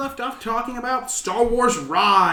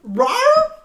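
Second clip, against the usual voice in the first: shocked laughing disbelief; 11 dB louder than usual. Real, not acted. Still reacting to the sound.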